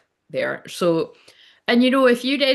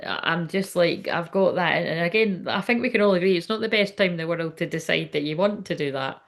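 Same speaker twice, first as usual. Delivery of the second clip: ordinary talk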